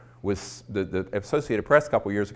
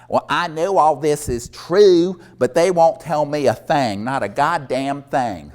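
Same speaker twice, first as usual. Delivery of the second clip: loud, raised, drawn-out impression of a complaining president; Bill Clinton; 8 semitones higher, 8 dB louder, slower than usual. Woman mimicking the president over the secret briefing, loud exaggerated impression. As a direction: play up the president's frustration, loud and high. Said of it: "did a perfect imitation of Bill Clinton".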